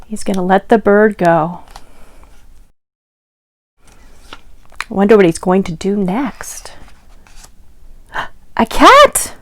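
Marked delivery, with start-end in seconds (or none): 3.9-6.7 hushed, secretive whisper. Acted. Reader teasing the kids about the next page, whispering. Suspense before page turn. 8.1-9.4 sharp gasp, then much higher cry; gasping surprise. Acted. Reader revealing the cat to children, gasping high.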